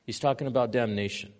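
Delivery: ordinary delivery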